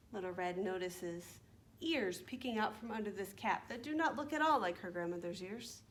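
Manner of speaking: ordinary delivery, nothing marked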